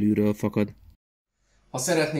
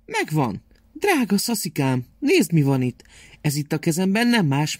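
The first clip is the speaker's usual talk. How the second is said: high, caring grandmother voice; loving grandma; 3 semitones higher than usual. Acted. Grandma comforts sad Szaszi, high loving voice.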